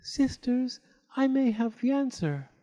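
high, timid little voice; shy youngest fairy. A narrator's reading. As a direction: offer it shyly. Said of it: "said shyly".

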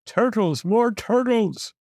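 high, drawn-out braying call; bellowed and brayed. A narrator's reading. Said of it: "he bellowed and brayed".

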